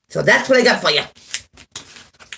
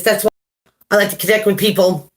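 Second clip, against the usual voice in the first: normal talking voice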